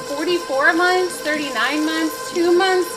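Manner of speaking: high, loud, rising excitement; excited. Real, not acted. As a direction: pile the numbers up excitedly. Excited at many resubs.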